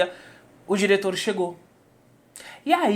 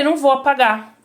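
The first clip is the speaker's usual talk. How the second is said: high, raised-voice defiance; loud refusal; 7 semitones higher, 7 dB louder than usual. Retold or imitated. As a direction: refuse forcefully, pitch and volume up. Repeated refusal, now escalated. (recusa em voz alta, exaltada)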